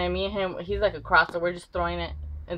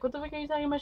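put-on denying boy; 8 semitones higher, 5 dB quieter, much faster than usual. Demonte denies throwing.

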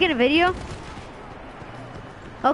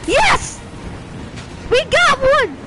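excited cheering; 8 semitones higher, slower than usual. Real, not acted. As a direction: cheer every hit like a fan. Cheering kills in video game.

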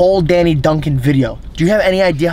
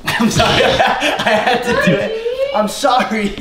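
high, slow giggling apology; laughing apology; 9 semitones higher, slower than usual. Real, not acted. [name] apologises to the pet while laughing.

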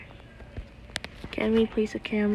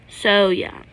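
usual speaking voice

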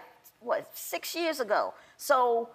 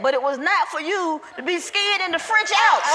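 preacher voice; 5 semitones higher, 6 dB louder than usual. Retold or imitated. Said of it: "then he said".

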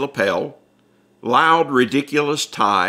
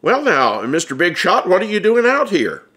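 higher, ribbing Mister Big Shot; teasing Bud; 9 semitones higher, 3 dB louder than usual. Acted. Bud ribs Ripley about being a big shot, higher and playful. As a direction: needle an old friend who got famous.

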